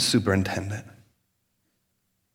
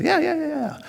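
much higher, quick eager yeahs; mock eager agreement; 13 semitones higher than usual. Congregation agreeing on education, much higher yeahs. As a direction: agree with everything, hammed up.

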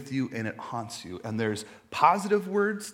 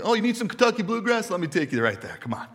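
eager salesman; 8 semitones higher, 5 dB louder than usual. Retold or imitated. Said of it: "I could read the back of a bag".

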